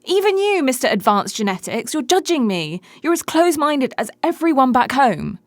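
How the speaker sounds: high, loud indignant protest; mock-hurt accusation, high and loud; indignant outburst. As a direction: accuse him, voice jumping up.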